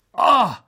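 sharp startled cry; sudden shout. Acted. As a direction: yell out in alarm. Nearly missing the window. (erschrockener Ausruf)

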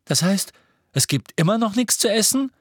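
high, whining protest; indignant complaint. A narrator's reading. Hungry Ron hears there is no food, indignant. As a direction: complain in disbelief, pitch climbing.

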